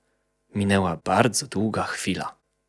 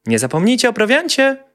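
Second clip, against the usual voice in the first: high, louder call from the house; mother shouting; 14 semitones higher, 4 dB louder than usual. A narrator's reading. Mother shouting to her family leaving. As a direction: shout a reminder after them. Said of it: "krzyknęła mama niedźwiadka".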